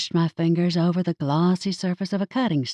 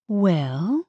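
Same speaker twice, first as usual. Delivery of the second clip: slow start; 4 semitones higher than usual. Acted. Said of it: "I slowly started".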